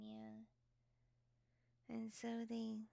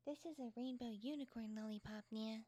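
ordinary talk, nothing marked

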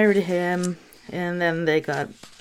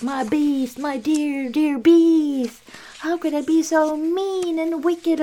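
high, hammed-up lament; 8 semitones higher than usual. Beauty mourning her beast in a hammed-up high wail. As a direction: wail over him lightly. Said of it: "being like".

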